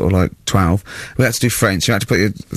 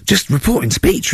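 normal talking voice

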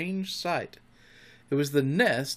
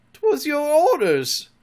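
slow, high-pitched excuse; high, hesitant protest; faltering; 7 dB louder, slower than usual. A narrator's reading. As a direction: falter before an angry captain. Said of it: "the boatswain faltered".

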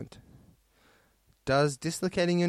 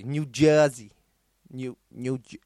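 louder mock New Jersey accent; New Jersey accent attempt; 4 dB louder than usual. Shown as a demonstration. Playing with local accent.

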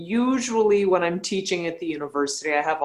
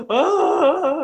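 loud high wail, imitating her own crying; a retold sob burst out high and loud; mock wail; 12 semitones higher, 7 dB louder than usual. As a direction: let out a hammed-up sobbing cry. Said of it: "I was crying and crying".

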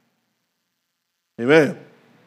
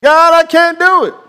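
loud anguished prayer; pleading cry; 7 dB louder than usual. Acted. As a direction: cry out God, I'm weak. Gideon's cry to God.

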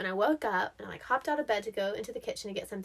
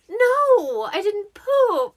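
much higher, louder, slow denial; 10 semitones higher, 11 dB louder, much slower than usual. Retold or imitated. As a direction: deny it hard, offended. Retold denial to mom.

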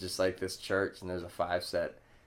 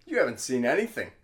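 mock protest; 5 semitones higher, 5 dB louder, faster than usual. Acts out retort to people.

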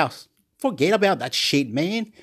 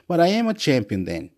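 ordinary talk

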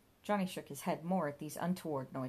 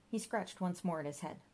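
plain reading voice

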